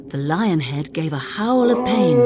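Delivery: ordinary delivery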